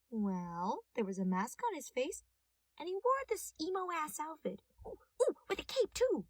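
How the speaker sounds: eager chatty witness; excited detective. A narrator's reading. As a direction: gush about the cape. Detective excitedly describes thief.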